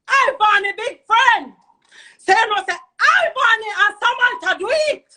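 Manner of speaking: loud, high, drawn-out accusation; real angry yell, pitched high; outraged outburst. Real, not acted. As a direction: shout the names out in outrage. Outraged naming of the culprits.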